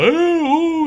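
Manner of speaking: high, loud mock moan of ooh, ooh; imitating Al Pacino moaning. Reviewer mocks the actor's overacting, hammed-up high moaning. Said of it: "he does a lot of moaning".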